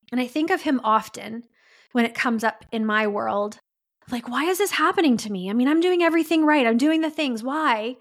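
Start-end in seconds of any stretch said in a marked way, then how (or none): 4.3-8.0 exasperated self-pity. Retold or imitated. Voicing own victim complaint.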